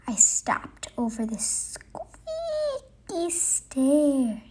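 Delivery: a slow, tense whisper; creeping whisper. Acted. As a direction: ease over the stair in a whisper. Creeping over a squeaky stair.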